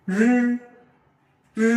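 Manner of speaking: রি held long from the throat; throaty রি. Shown as a demonstration. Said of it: "উচ্চারণ হচ্ছে গলা থেকে".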